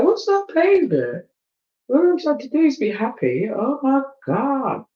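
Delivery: high-pitched exasperated mock wail; mock anguish. Telling viewers how pain makes you react, mock anguish.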